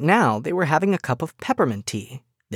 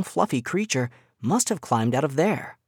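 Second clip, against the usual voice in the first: ordinary delivery, nothing marked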